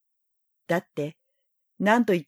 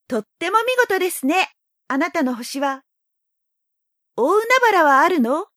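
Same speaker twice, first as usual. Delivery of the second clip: put-on high, admiring little boy; young boy voice; 9 semitones higher, 4 dB louder than usual. A narrator's reading. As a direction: ask with wide-eyed wonder. Prince admires the planet.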